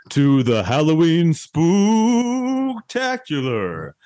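slow, drawn-out spooky show host; jack o lantern voice. Acted. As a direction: ham up a haunted-house host welcome. Hosts Halloween show intro.

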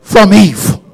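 high, loud climbing shout; emphatic cry. Retold or imitated. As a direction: shout the warning with all force. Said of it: "put some more emphasis on it".

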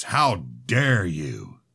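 slow, drawn-out booming challenge. A narrator's reading. Father rebuking his son with a slow booming voice. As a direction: stretch each word out like a pulpit rebuke. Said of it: "his booming voice".

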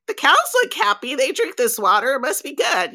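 high, quick, excited retold thought; eager reasoning. Tourist reasoning that happy cows mean good water, eager.

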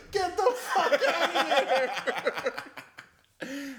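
slow, high, loud laughing kiss-off; mock dismissal. Bar guy telling a Guinness thief off, slow loud yell. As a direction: draw it out and throw him out. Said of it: "he's like".

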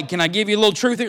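ordinary delivery

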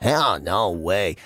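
very low, soft and slow mock voice; deep slow friend voice. Imitating the unlucky friend, very low, soft and slow. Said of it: "he talks like this".

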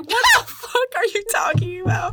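high, incredulous mock yelp; mock baffled boyfriend. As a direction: squeak out his disbelief in a high voice. Retold vampire boyfriend's reaction.

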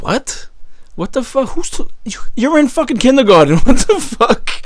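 climbing high, laughing shocked outburst; mock outrage that breaks into a laugh; shocked disbelief. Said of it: "I was like".

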